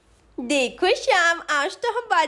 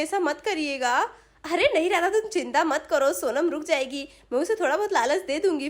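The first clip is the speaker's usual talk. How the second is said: plain reading voice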